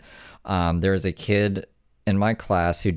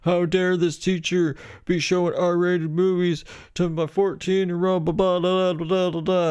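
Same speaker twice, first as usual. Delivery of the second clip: freaking-out stepmom; 12 semitones higher than usual. Retold or imitated. Stepmom outraged over an R-rated movie, mock freak-out. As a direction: imitate an outraged stepmom, pitched way up. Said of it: "she freaked the fuck out".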